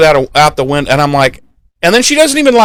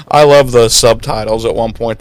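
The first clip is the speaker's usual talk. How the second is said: ordinary delivery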